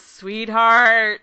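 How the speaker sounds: loud, drawn-out mock greeting; effusive greeting. Director greets a fan with open arms, effusive. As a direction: call it out like greeting a dear friend.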